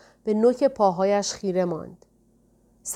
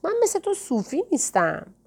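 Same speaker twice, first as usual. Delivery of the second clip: high-pitched protest without real volume; impatient cry; 9 semitones higher than usual. A narrator's reading. Ella protests to Aziz that she is no Sufi, mildly impatient cry. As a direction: exclaim impatiently, pitch jumping up, a restrained narrated yell.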